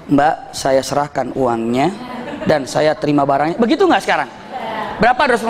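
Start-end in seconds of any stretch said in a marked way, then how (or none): 0.1-3.5 mock formal buyer. Mock formal ijab kabul at shop.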